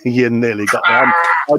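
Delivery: laughing through the words You nearly got me. Real, not acted. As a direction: chuckle as you say it, still amused. Laughing after the joke.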